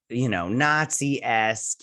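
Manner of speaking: ordinary talk, nothing marked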